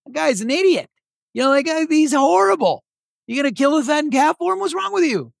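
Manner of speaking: high, whiny mock-outraged older brother; mock indignant brother. Preacher mimics the jealous older brother, high and whiny. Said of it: "he's mad".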